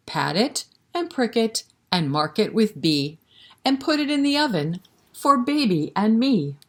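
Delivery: put-on sing-song rhyme read to little kids; lilting, rhythmic recitation for toddlers; nursery rhyme recital. Reciting together.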